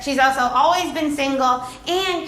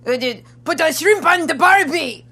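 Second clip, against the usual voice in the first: put-on deliberately awful Australian accent, a bit louder; bad Australian accent; 3 semitones higher, 4 dB louder than usual. Showing off her worst accent, a terrible Australian accent. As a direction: ham up a clumsy Aussie accent. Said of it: "Australia's probably the worst one".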